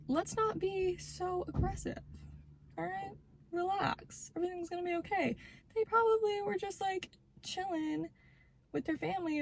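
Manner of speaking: high, very soft reassurance; soft, high-pitched calming voice; soothing. Acted. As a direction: talk them down gently, high and hushed. Soothing the imagined angry partner.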